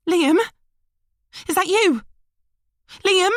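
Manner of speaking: high, loud frightened calling; worried woman. A narrator's reading. Emily calling for Liam over the phone, frightened. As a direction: call into the phone, scared.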